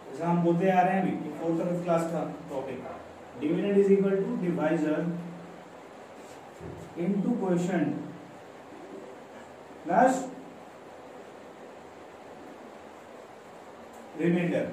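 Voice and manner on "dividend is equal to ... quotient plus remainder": slow dictation. Shown as a demonstration. Teacher dictates formula to students.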